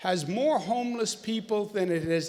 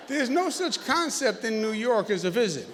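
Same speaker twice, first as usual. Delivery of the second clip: usual speaking voice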